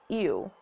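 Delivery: slow, drawn-out "ew" for the letter U; British U sound. Shown as a demonstration. Player reading the pronunciation rule, drawn-out "ew". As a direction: say "ew" as a gliding "yoo". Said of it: "the letter U is pronounced".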